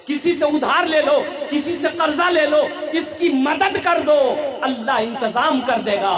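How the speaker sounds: loud, high impassioned urging; urgent command. Acted. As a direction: press the servant with full-voiced orders. Prophet voiced urging Bilal.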